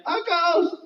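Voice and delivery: high-pitched cry of alarm; fearful cry. Acted. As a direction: cry out as if a ghost appeared. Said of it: "they cried out for fear, saying".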